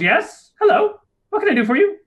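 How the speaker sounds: high, squeaky little gnome greeting; tiny gnome voice. Acted. Tiny gnome answering a goblin's knock, high and squeaky. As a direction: pipe up like the tiniest gnome. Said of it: "He pokes his head out and goes".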